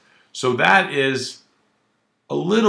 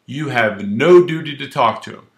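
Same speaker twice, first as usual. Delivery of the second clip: ordinary delivery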